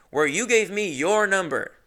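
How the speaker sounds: ordinary delivery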